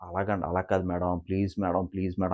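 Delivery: low, soft, placating plea; imitated pleading. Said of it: "చాలా బతిమలాడుతాడు". (మెల్లగా బతిమలాడుతూ)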